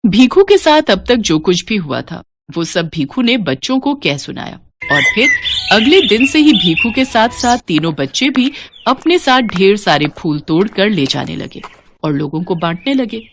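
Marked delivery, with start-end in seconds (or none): none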